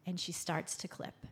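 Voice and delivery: usual speaking voice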